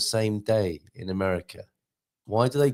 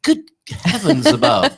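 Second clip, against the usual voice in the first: surprised; 12 semitones higher, 8 dB louder, slower than usual. Real, not acted. Surprised at episode fifty-three.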